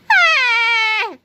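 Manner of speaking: long, high, loud scream. A narrator's reading. Melvin fleeing the angry mob, screaming. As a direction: scream in cartoon panic. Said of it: "screamed Melvin as he ran away".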